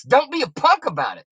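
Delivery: normal talking voice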